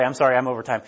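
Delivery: ordinary delivery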